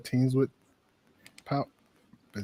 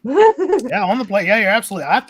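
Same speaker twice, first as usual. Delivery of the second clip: excited; 9 semitones higher, 8 dB louder than usual. Real, not acted. Excited agreement, much higher, louder.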